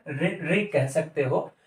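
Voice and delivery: रि said with a short i, quick and light; short ri sound. Shown as a demonstration. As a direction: say रि with a clipped short vowel. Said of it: "इसका उच्चारण". (रि को छोटी इ के साथ बोलें)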